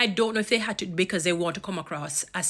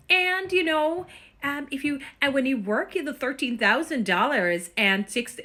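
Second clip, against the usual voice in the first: mock North American accent, higher pitched; imitated North American accent; 8 semitones higher, 3 dB louder than usual. Vlogger imitating young campaigners who lost their accent, mock North American accent. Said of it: "I don't know where the accent went".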